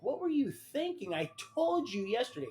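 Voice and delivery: loud, high-pitched angry scolding; retold irate telling-off; imitating mad brother. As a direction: scold him in exasperation, voice climbing. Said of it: "He's mad. He's mad. He's like".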